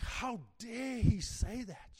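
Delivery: loud, high, drawn-out outrage; indignant anger. Acted. Pharisees reacting to Jesus's public statements, indignant and angry. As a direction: swell up with affronted indignation. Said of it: "indignation and anger".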